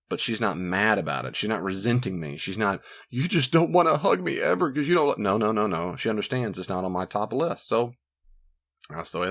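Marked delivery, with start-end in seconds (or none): 3.1-5.2 higher, whiny hint of a hurt wife; a touch of a sulking spouse, pouting; lightly voiced resentful wife. Husband imitating a wife who resents missing hugs, mildly whiny.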